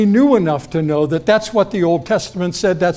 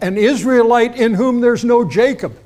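high, loud pronouncement; loud proclamation; 6 semitones higher, 5 dB louder than usual. Retold or imitated. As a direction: declare it boldly with a hidden meaning. Jesus' greeting with hidden meaning.

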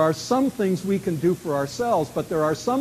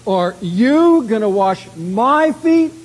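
YOU and MY both stretched high and loud; slow, loud question leaning hard on YOU and MY; stress on YOU and MY; 5 semitones higher, 8 dB louder, slower than usual. Acted. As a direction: punch YOU, then punch MY, indignantly. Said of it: "that's emphasized in the text".

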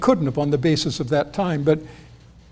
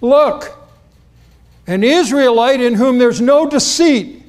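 called out high and loud across the distance; 7 semitones higher, 7 dB louder than usual. Retold or imitated. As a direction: announce him loudly as he approaches. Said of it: "Jesus apparently says it loudly".

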